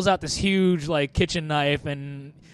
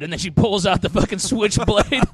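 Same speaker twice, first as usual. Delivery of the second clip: normal talking voice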